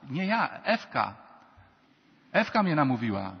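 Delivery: loud, quick-to-deny Adam; emphatic mock protest of innocence; mock defensive blame. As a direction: pass the blame, hammed up. Acts Adam blaming Eve.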